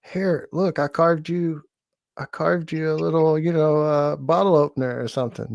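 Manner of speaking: high, eager brown-noser voice; butter up the guy in charge; sucking up. Pretend. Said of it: "I'll be like".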